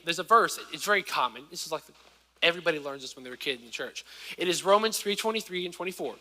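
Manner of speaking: normal talking voice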